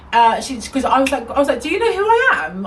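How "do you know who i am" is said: high-pitched indignant retort; haughty challenge. Retold or imitated. Customer confronting the shop worker, mock-haughty indignation. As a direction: play up the affronted customer. Retold comeback in shop quarrel.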